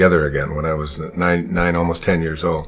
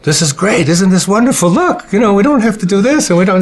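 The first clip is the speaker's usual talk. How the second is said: put-on high, bright, delighted child's cry, louder; excited child; 13 semitones higher, 5 dB louder than usual. Mother retelling baby Wayne's cheer in the slush, excited. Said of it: "stood up and said".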